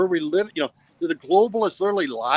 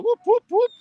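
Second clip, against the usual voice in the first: high, loud party whoops; whoop, whoop, whoop as a rising hype chant; whooping cheer; 5 dB louder than usual. Real, not acted. Cheering his lifestyle slogan.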